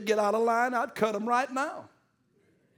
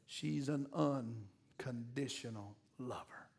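slow, soft whispered line; 9 semitones lower, 16 dB quieter, slower than usual. Acted. Preacher whispers about mothers to the congregation, slow and hushed. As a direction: breathe it out tenderly.

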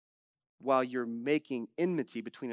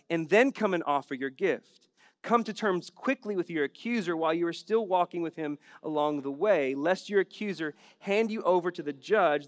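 louder, higher preaching of the quoted verses; forceful scripture reading; 3 semitones higher, 9 dB louder than usual. Acted. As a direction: proclaim the verses with full force. Preacher reading Jesus' words forcefully.

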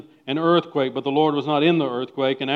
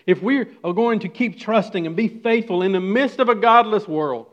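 loud, high impassioned preaching; preaching at full force; 7 semitones higher, 9 dB louder than usual. Acted. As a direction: drive the point home to the congregation.

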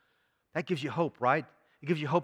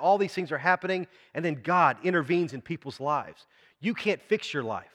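ordinary delivery

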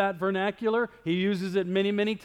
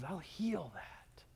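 breathy whispered promise, slow; 16 dB quieter, much slower than usual. Acted. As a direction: whisper it gently, slowly. God's promise voiced softly.

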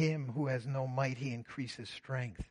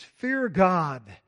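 louder, proclaimed call; loud angel's command; 3 semitones higher, 12 dB louder than usual. Retold or imitated. Preacher reading the angel's loud cry, louder. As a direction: boom it out like the angel's voice. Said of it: "said with a loud voice".

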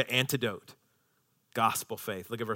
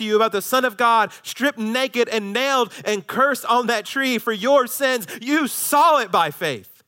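high, driving sermon delivery; impassioned preaching; 9 semitones higher, 6 dB louder than usual. Acted. Preacher speaking as Paul to the Galatians, impassioned preaching. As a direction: build a vivid testimony in a raised voice.

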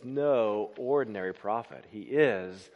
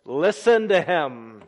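loud and slow, listen to him; ringing command from heaven; booming voice of God; 7 dB louder, slower than usual. Shown as a demonstration. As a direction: proclaim it like the voice from heaven. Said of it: "And then this".

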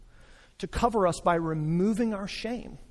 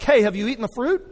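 louder, quick pretend-clueless question; hammed-up playing dumb; mock feigned ignorance; 5 semitones higher, 6 dB louder, faster than usual. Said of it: "He's not like".

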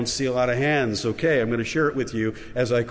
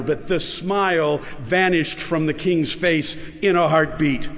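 ordinary talk, nothing marked

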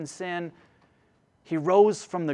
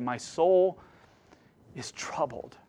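fading almost to nothing on troubled; Jesus's quiet anguish; 4 dB quieter, slower than usual. Acted. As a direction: let the words sink into a hush. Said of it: "when he cried out to God".

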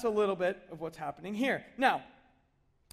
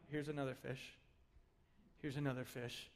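low, soft, utterly unexcited repetition; bored monotone; 9 semitones lower, 16 dB quieter than usual. Retold or imitated. Fishermen mock-bored while hauling in fish, low and flat. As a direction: drone it like it means nothing. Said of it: "just going like".

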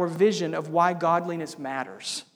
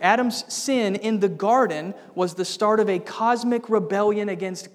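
louder, faster, driving sermon push; preaching at full force; 4 semitones higher, 6 dB louder than usual. Acted. Preacher returning to his sermon, fast and forceful. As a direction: press the point home urgently.

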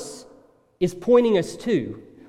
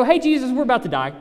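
louder, higher, fast panicked cry; panicked wake-up call; 8 semitones higher, 6 dB louder, much faster than usual. Retold or imitated. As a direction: blurt it out like frightened fishermen shaking him awake. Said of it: "wake him up and go".

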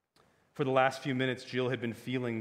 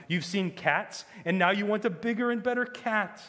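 higher-pitched, emphatic belittling; mocking argument; 8 semitones higher, 6 dB louder than usual. A narrator's reading. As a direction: make their belief sound childish. Witch mocks belief in lion.

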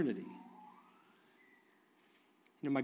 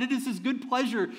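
ordinary talk, nothing marked

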